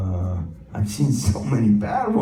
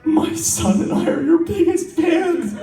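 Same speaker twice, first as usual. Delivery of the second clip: excited fan voice. Retold or imitated. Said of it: "He goes".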